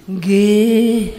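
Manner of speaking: long, drawn-out docile yes; obedient wife. Acted. Solo wife answering husband's order, drawn-out obedient reply. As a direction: answer in a meek held note.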